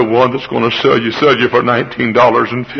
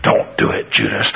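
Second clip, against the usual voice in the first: whispered, much softer; pleading whisper; 5 dB quieter than usual. Acted. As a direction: warn him under your breath. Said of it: "ping-pong ball going side to side".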